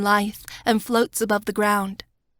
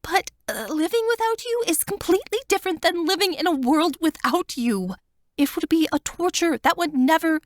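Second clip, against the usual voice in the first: higher, tearful plea to her friend; Wanda's voice; 9 semitones higher than usual. A narrator's reading. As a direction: voice her pleading, higher and fuller. Wanda pleading with narrator.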